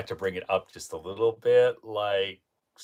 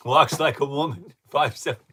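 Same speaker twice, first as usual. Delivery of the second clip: laughing; 6 semitones higher, 7 dB louder than usual. Real, not acted. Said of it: "this is funny".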